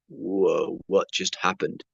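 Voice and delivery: low, soft stunned reaction; reeling quietly, voice dropped right down; retold daze, hushed and low; dazed. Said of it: "like, dazed".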